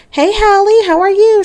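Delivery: high-pitched friendly mom voice; mom's greeting. Acted. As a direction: greet her in a high sociable voice. Mom greets son's girlfriend.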